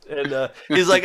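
usual speaking voice

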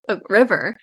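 ordinary delivery

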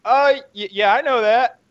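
mock-flustered guest pretending he knew. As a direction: play him caught out and bluffing. Imitating guest caught out.

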